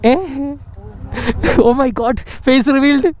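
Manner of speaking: high hammed-up gasp of shock; mock surprise. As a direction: overplay the shock.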